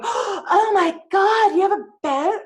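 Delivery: high, slow, breathless gush; gushing gasp. Pretend. Not how she reacted: a gushing, breathless boat gasp. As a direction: gasp and gush, starry-eyed. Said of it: "So it wasn't like".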